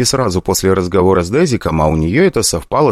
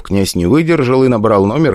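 usual speaking voice

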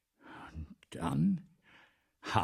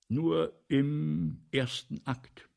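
slow qualified praise; 3 semitones higher, much slower than usual. Acted. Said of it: "nochmals der Direktor".

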